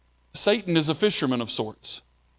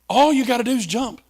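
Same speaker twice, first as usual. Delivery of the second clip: much higher, fast, insistent coaxing; Satan pushing harder; 9 semitones higher, 3 dB louder, faster than usual. Retold or imitated. Preacher voicing Satan pressing Jesus to jump, higher and insistent. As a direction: press the temptation harder, pitch climbing. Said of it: "emotional ploy put in by the devil".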